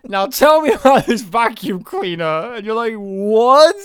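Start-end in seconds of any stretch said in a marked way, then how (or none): 0.1-2.5 stern mum. Retold or imitated. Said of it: "the mum sternly says". 2.9-3.7 loud disbelieving what; baffled outburst; incredulous what. Retold or imitated. Stunned viewer reacting to the mum, loud incredulous what.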